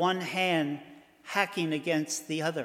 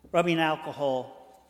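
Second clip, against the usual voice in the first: ordinary delivery, nothing marked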